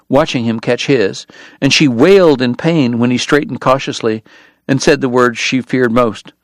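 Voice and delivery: ordinary delivery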